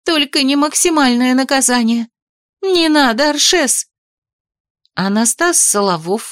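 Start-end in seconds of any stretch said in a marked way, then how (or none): 0.0-3.9 mock pleading. Heroine play-acting pleading.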